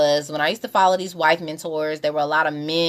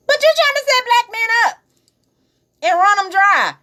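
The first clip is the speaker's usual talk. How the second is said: very high, loud incredulous outburst; exclaimed rebuttal; 6 dB louder than usual. Real, not acted. Answering women who complain about protection, loud high exclamation. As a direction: throw it back at them, loud and pitched way up.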